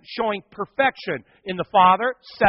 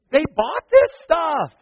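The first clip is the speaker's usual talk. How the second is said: gleeful; 10 semitones higher, 7 dB louder, slower than usual. Retold or imitated. Gleeful thought while leading group.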